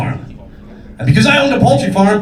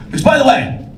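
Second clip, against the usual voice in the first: ordinary talk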